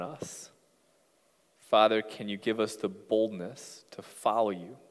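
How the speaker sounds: low-key, measured closing prayer. Real, not acted. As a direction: pray softly, phrase by phrase. Closes the sermon in prayer.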